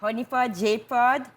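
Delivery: lively host signing off; show host sign-off. Pretend. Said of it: "sign out in the different languages".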